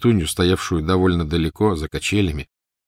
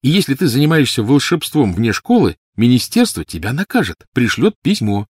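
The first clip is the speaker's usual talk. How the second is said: put-on high, louder child's voice, explaining; boy Snape, knowledgeable; 6 semitones higher, 5 dB louder than usual. A narrator's reading. Snape teaching Lily wizarding law, earnest.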